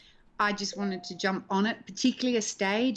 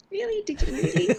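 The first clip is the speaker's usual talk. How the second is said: mock surprise; 11 semitones higher than usual. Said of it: "And I was like".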